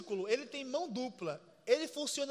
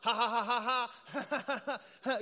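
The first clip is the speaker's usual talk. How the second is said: a deliberately fake ha ha ha; demonstrating a laugh that isn't genuine; forced laugh; 2 semitones lower, much slower than usual. Shown as a demonstration. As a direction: laugh on purpose, with no real joke behind it. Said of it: "quando você começa a rir do nada assim".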